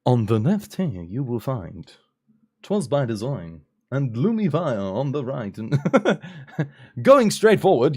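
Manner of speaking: flight attendant. Acted. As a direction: point out exits like cabin crew. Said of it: "I sound like a flight attendant".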